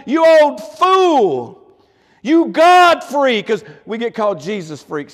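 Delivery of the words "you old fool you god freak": slow, drawn-out mocking taunt of a neighbour; scoffer's taunt. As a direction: sneer it out slowly like a scornful neighbour. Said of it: "he's probably called things like".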